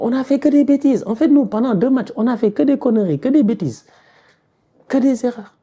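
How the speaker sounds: high, pressed venting; real high-pitched exasperation; exasperated outburst. Real, not acted. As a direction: let frustration push the voice right up. Venting at team's mistakes.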